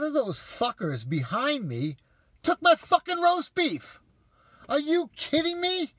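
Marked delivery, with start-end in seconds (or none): 2.4-5.9 outraged. Real, not acted. Outraged at stolen roast beef.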